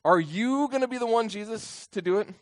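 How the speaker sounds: "you" punched high and loud; stress on YOU. Shown as a demonstration. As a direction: hit "you" hard in a high, insistent question. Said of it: "do you see what I'm emphasizing here".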